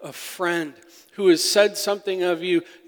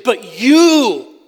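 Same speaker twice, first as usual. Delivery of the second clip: "But you" punched loud, "you" higher and falling; emphatic quote; 7 semitones higher, 14 dB louder than usual. Acted. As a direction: voice David's turn to God with force. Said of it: "David in the Hebrew is saying".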